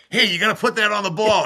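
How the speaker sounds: high, playful teasing call; lifted teammate voice. Retold or imitated. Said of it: "someone would go like".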